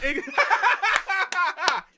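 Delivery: big burst of high laughter; loud laugh. Real, not acted. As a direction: crack up hard. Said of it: "You made it work".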